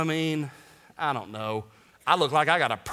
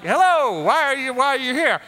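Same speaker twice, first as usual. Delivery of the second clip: put-on louder, higher hello without the accent; startling angel greeting; 9 semitones higher, 7 dB louder than usual. Angel in tomb greets the women, loud startling hello. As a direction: call out to the visitors as the angel. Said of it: "Let's disregard that".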